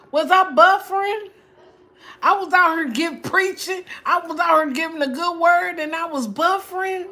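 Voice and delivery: high, loud outraged protest; loud indignant complaint. Real, not acted. To viewers, loudly protesting the buffering.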